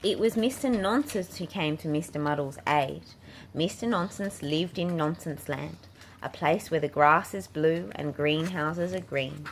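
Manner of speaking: usual speaking voice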